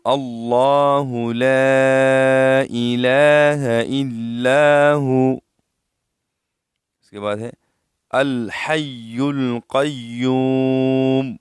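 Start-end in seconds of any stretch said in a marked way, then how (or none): 0.0-5.4 slow, louder tajweed chant; Quran recitation. Acted. Recites the verse. 8.1-11.4 slow, louder tajweed chant; Quran recitation. Acted. Recites the verse.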